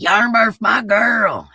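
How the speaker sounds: high, loud happy shout-out; excited greeting. Real, not acted. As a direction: call out to a friend. Greeting a chat member.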